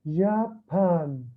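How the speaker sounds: "جابان" slowly, in two distinct beats; split into syllables. Shown as a demonstration. Teacher asking how many syllables, word slowly split in two. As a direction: hold جا, pause, then بان in "جابان". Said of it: "هلا شوف الكلمة اللي قرأتها".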